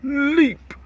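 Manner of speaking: spring up with it; high leap cry. A narrator's reading. Narrator voicing the giant jump.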